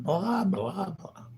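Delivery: high, loud mocking blah. Mocking the guy who won't stop talking, high and loud. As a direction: parrot the chatter with scorn.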